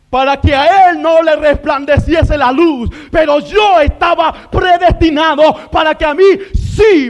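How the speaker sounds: loud, insistent sermon cadence; full-force preaching. Real, not acted. Preacher assuring believers they were predestined for light, full-force preaching. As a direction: punch each contrast with rising fervour.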